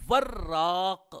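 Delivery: وراق drawn out very slowly, wa with a fatha and a doubled rr; teacher models وراق slowly for students; careful pronunciation. Shown as a demonstration. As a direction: stretch وراق out, leaning on the doubled r. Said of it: "واو کے فتحہ کے ساتھ ہے اور را جو ہے وہ مشددہ ہے".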